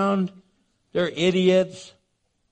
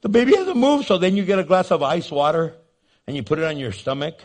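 usual speaking voice

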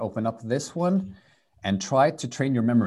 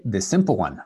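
usual speaking voice

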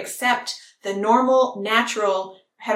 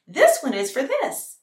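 slightly hammed-up cheer, higher and slower; excited presenter; 5 semitones higher, 4 dB louder, slower than usual. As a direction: present it to little kids with mock delight. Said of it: "you're all excited and happy".

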